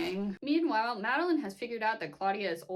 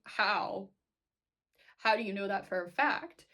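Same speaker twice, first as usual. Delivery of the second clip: insistent how; 7 dB louder than usual. Retold or imitated. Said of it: "I had to be like".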